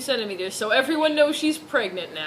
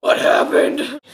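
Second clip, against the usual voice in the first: dramatic reading; 9 dB louder than usual. Acted. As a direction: read it like melodrama. Misread line read dramatically.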